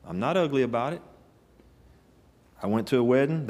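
normal talking voice

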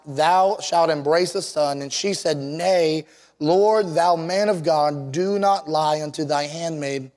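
ordinary delivery

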